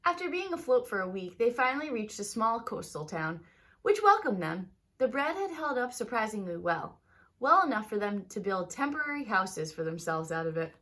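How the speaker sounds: lively read-aloud storytelling voice; story narration. A narrator's reading. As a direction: tell it like a bedtime story to kids. Teacher reads picture book aloud.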